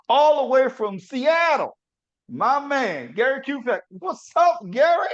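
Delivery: high, loud announcer build-up; big guest introduction. Acted. Host bringing on his friend from Seattle, big hyped announcement. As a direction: call the guest out like a ring announcer.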